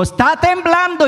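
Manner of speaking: alarmed cry. Retold or imitated. Man sees the next tower swaying and cries out in alarm. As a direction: shout it the moment you see the building sway.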